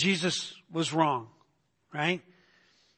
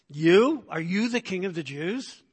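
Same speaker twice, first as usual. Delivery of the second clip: disbelief. Acted. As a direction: question him as if you can't believe it. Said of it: "a tone of disbelief".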